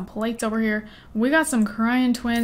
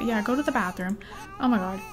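usual speaking voice

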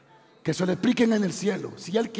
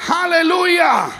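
high, loud, drawn-out hallelujah; praise shout; 9 semitones higher, 11 dB louder, much slower than usual. Real, not acted. Preacher praising the sovereign God, a long ringing shout. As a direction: cry out praise at full voice.

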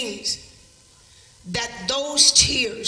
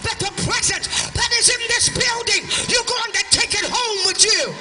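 high, loud revival sermon voice; full-force preaching; 9 semitones higher, 3 dB louder than usual. Real, not acted. Preacher declaring revival over the city to a congregation, full-force preaching. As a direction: preach it in long, driving, shouted phrases.

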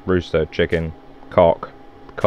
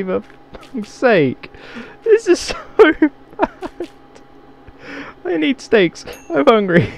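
giggling fit. Real, not acted. Gamer giggling hard at the steps he built for monsters. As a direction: squeal through the giggles.

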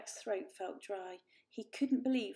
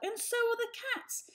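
much higher, louder plea; pleading kitten; 5 dB louder than usual. A narrator's reading. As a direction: insist desperately. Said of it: "said Varjak".

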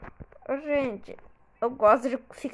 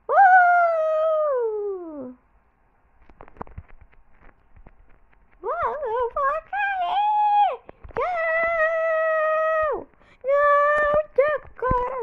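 much higher mock panic, slow wail; hammed-up falling scream; 5 dB louder, much slower than usual. Gamer fakes panic while falling off, hammed-up falling scream. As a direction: play a cartoonish fall.

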